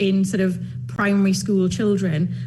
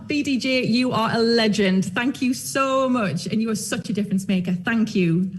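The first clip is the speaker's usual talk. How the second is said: ordinary talk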